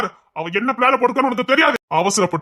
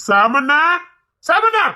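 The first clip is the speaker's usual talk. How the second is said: calling out; 7 semitones higher, much slower than usual. Acted. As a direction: shout for him to come.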